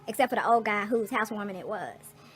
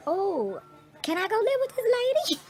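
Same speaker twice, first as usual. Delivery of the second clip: put-on high pleading baby voice, far higher; 11 semitones higher, 4 dB louder than usual. Speaking as a newborn to her, high baby voice. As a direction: voice a tiny baby begging sweetly. Voicing the baby's thoughts.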